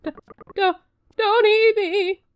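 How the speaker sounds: high, loud, slow panicked plea; a terrified little man begging the monster not to eat him; stammering fright. Acted. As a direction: stutter then shriek don't eat me. Voicing a scared game character.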